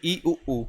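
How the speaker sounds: ई and ऊ sounded out carefully, mouth nearly closed; vowel demo. Shown as a demonstration. Teacher giving examples of closed vowels, careful model sounds. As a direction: model ई, ऊ for students to copy. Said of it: "जैसे ई".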